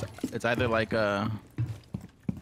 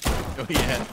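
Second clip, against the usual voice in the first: ordinary talk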